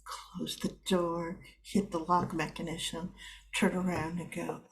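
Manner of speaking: ordinary talk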